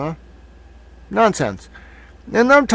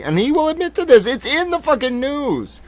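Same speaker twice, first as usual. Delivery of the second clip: pitched-up outraged aside; ranting higher; 11 semitones higher than usual. Real, not acted. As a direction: pitch jumps way up, indignant. Ranting about rich people's cocaine.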